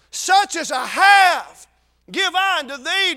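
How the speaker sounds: slow, loud, ringing proclamation; drawn-out preacher shout; Peter's bold command. Retold or imitated. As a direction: declare it slowly with full force like an apostle. Preacher voicing Peter to lame man.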